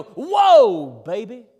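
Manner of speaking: loud, high "whoa", then dropping back; sudden booming exclamation, imitating dad; dad's excited whoa. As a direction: imitate dad calling out at a sight. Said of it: "my daddy would go".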